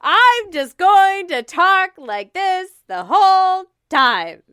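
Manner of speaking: "I'm just going to talk like this" declaimed high, loud and slow; mock iambic pentameter. Co-host trying out verse, "talk like this" in loud, slow, singsong stress. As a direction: boom "the whole time" out in exaggerated verse beats. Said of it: "Is that my iambic pentameter?".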